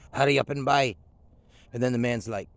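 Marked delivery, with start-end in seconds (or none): 0.1-1.0 impatient store owner. Retold or imitated. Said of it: "But she's like".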